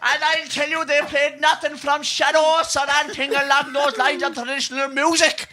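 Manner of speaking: ranting in a Kerry brogue about a chip shop order; Healy-Rae impression. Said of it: "what if they're down in Roberto's later".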